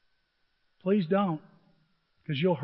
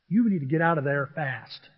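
ordinary delivery

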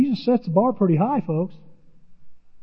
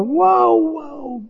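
amazed whoa; 10 semitones higher than usual. Real, not acted. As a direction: marvel at it. Amazed at God's mercy.

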